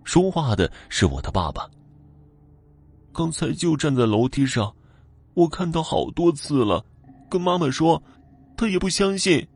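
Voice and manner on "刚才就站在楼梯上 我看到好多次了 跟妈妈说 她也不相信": put-on higher, hurt child's complaint; aggrieved. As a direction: sound wronged and upset. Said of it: "我委屈地说道".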